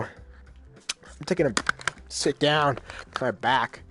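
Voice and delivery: ordinary talk, nothing marked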